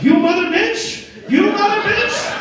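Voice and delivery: very high, loud, drawn-out yell; imitated furious cursing. Uber driver cursing during the beating, high mock shriek. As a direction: shriek it slowly as a comic battle cry.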